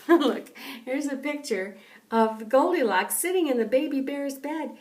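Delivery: usual speaking voice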